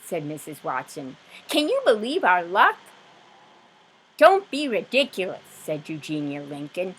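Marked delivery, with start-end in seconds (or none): 1.5-2.8 higher and louder, thrilled; excited. Acted. Wife thrilled to neighbour, high and loud. 4.2-5.4 higher, louder scoff; scornful. Acted. Disapproving neighbour scolding wife, louder.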